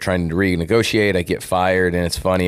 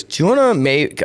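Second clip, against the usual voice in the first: higher, incredulous agent voice; imitating agent; 10 semitones higher, 4 dB louder than usual. Retelling his agent's shocked reaction, higher and incredulous. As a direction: play the stunned agent.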